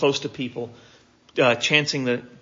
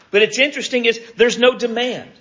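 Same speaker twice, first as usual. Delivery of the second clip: ordinary talk, nothing marked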